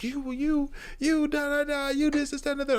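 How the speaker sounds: squeaky high mock rant of an upset girl; mocking angry girl. Mocking upset girlfriend yelling at him, high squeaky rant. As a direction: parody her ranting at you in a squeal. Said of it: "She's like".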